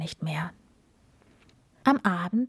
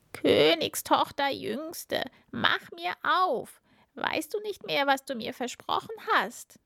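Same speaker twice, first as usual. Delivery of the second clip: frog calling out; 8 semitones higher than usual. A narrator's reading. Frog knocking a second time, calling out. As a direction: stretch the summons high, then press the question. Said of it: "der Frosch rief".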